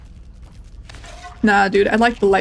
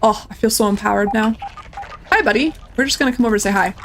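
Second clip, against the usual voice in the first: ordinary talk, nothing marked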